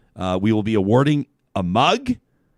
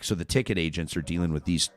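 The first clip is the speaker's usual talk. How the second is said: ordinary talk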